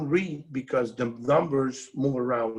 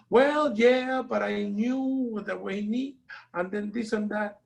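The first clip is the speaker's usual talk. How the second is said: excuse; 9 semitones higher than usual. Retold or imitated. Retold partner's excuse on phone.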